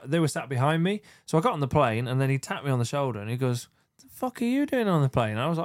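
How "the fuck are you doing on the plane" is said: imitating a startled mate, pitched high; surprised exclamation. Friend on the plane behind him, mock startled high. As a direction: retell the shocked question, much higher.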